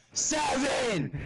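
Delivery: real indignant yell; outraged shout. Real, not acted. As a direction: shout the number in disbelief. Repeating damage number in outrage.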